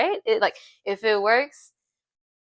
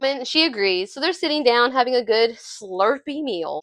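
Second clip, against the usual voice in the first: ordinary talk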